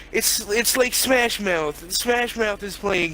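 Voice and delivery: usual speaking voice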